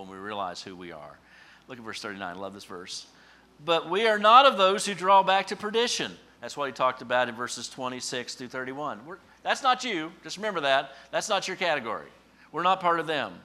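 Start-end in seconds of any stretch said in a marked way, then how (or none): 3.6-6.2 much higher, loud preaching cry; preaching shout. Real, not acted. Preacher to congregation, shouting the verse at full force. 9.1-13.3 much higher, loud insistence; loud preaching. Real, not acted. Preacher to congregation, loudly insisting they do not belong.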